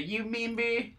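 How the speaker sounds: high, soft, breathy creepy croon; high whispery member berries. Acted. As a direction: coo it like the creepy grape. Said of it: "my attempt at member berries".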